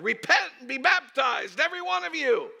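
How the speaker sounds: loud, high street-preacher yell; fiery high-pitched shout of warning; yelled preaching. Retold or imitated. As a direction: cry it out to a whole crowd. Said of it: "He yelled".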